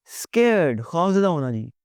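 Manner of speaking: normal talking voice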